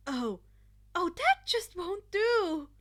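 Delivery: high, loud slow exclamation; dramatic dismay. Acted. Friend reacting to Shoto, high and dramatic. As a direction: gasp in playful outrage.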